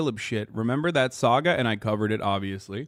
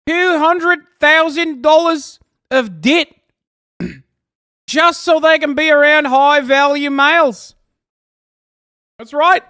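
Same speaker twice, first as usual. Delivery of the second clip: loud, high mock Aussie rant; hammed-up Aussie accent, loud and high; 8 semitones higher, 8 dB louder than usual. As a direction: rant like a brash Aussie bloke, pitched up. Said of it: "the sneaky Aussie accent".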